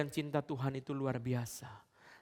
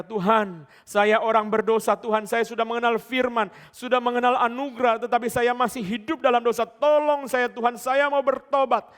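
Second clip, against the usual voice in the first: fervent preaching prayer; 10 semitones higher, 14 dB louder than usual. Acted. Preacher voicing the congregation's prayer to God, fervent loud pleading. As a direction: cry out the confession at full force, voice climbing. Altar call prayer, full force.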